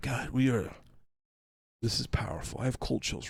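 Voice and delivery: hushed, soft mock reverence; mock awed filmmaker. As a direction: murmur it as if moved by your own film. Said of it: "the whole time they're like".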